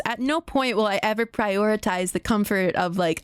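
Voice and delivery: ordinary delivery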